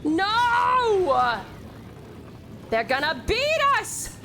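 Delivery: high, drawn-out wail of defeat; despairing cry. Real, not acted. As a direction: wail as rivals overtake you. Losing the race.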